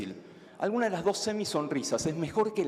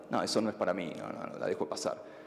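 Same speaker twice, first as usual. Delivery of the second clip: lower, fading dismissive brush-off; 10 semitones lower, 5 dB quieter than usual. Pretend. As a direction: wave it away, trailing into a breath. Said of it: "la dejamos pasar". (despectivo, apagándose en susurro)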